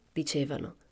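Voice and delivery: plain reading voice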